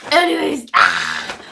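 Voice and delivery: loud excited yelp; sudden happy shout. Real, not acted. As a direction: burst out with excitement. Excited unboxing outburst.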